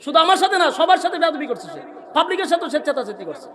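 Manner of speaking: loud, high complaint; angry complaint. Real, not acted. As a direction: denounce him loudly. Preacher complaining to crowd. (রাগী অভিযোগ)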